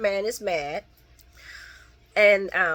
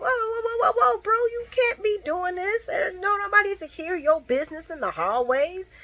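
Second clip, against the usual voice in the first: high mocking scoff at Esme; mock outraged yell; 10 semitones higher than usual. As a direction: mimic his scornful yell, pitched high. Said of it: "Jocelyn come out like".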